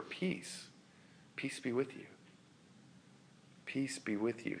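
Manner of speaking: Jesus whispering peace over and over; tender hushed Jesus. Acted. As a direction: soothe a frightened friend.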